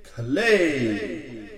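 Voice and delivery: slow, stretched, high booming announcer; boxing ring announcer. Acted. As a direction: draw out every name to the crowd. Ring introduction before the fight.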